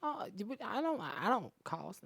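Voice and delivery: high, slow mock protest from a woman; imitating a pretty girl insisting; mock offended woman. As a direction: play her up, stretching it out. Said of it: "They be like".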